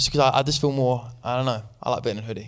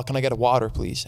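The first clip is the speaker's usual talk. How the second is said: put-on American "water" with a hard R; an English speaker trying to sound American; attempted American accent; 3 semitones lower than usual. English guest repeating his order for a waiter, attempted American accent. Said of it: "say it in an American accent".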